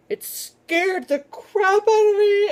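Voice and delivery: high, loud, slow mock wail; mock terrified. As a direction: ham up the fright with a whimper. Recalling a scary book.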